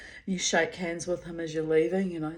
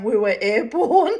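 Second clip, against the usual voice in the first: high, loud laughter breaking through the words; cracking up while telling it; laughing hard, voice climbing high; 14 semitones higher, 9 dB louder than usual. Real, not acted. Laughing retelling the seesaw fall.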